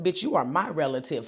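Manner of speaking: ordinary talk, nothing marked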